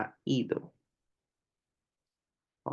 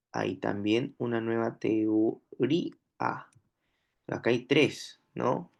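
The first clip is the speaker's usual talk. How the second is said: ordinary talk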